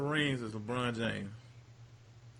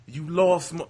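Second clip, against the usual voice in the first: loud, higher battle-rap jab; 5 semitones higher, 8 dB louder, slower than usual. Retold or imitated. Quoting a battle rapper's line, loud imitation. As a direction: throw it like a rap battle punchline. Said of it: "this is how he said it".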